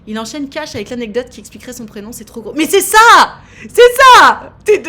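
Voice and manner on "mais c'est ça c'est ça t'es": loud high exclamation building up; rising excited cry; excited shout. Real, not acted. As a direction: burst out in disbelief. Excited at caller's persistence.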